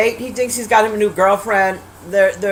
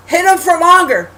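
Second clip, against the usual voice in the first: higher, louder exasperated yell; retold screaming at TV; 6 semitones higher, 5 dB louder than usual. Viewer urging the heroine to keep hitting, retold yelling. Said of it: "I'm screaming".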